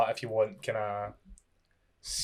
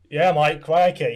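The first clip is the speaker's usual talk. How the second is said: loud, hammed-up Australian accent; mock Australian; 3 semitones higher, 9 dB louder, slower than usual. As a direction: play up a joke Australian accent. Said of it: "A lot of people think I'm Australian".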